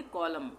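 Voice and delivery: कॉलम closing on M, no b; silent B. Shown as a demonstration. Teacher summing up the rule, कॉलम with silent B. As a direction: say कॉलम as "kolum". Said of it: "बी का उच्चारण नहीं करेंगे".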